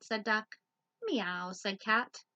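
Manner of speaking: lively loud picture-book read; reading to children. Acted. Teacher reading Duck on a Bike to children. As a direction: read it out big for little listeners.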